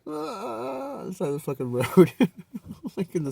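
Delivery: high, loud hammed-up wail; mock bawling. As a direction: wail it like a crybaby in the gravel. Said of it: "I'm, like, bawling".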